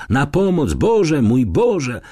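frightened calling out. A narrator's reading. Lonia cries for help from the thicket, frightened. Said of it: "Lonia wołała".

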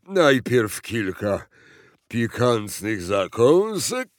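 slow, loud, ponderous sigh of appetite; sighing fat president. A narrator's reading. President orders snacks by pill, sighing. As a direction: sigh it out slowly, savouring. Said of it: "westchnął".

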